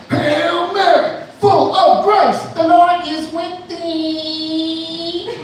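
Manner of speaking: very high, slow, nasal-free shouted rap yell; [name] impression. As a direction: bellow the prayer like a shouty rap trio. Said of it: "impression of the [name]".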